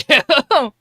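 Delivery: sudden loud cackle; real laughing outburst; loud laugh burst. Real, not acted.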